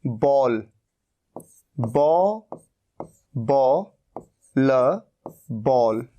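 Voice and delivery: बॉल said slowly, broken into बॉ and ल and then joined again; slow spelled-out pronunciation. Shown as a demonstration. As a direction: sound out बॉल bit by bit, then say it whole. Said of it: "ये है".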